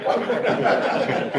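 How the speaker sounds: high burst of laughter; laughing at his own joke. Real, not acted.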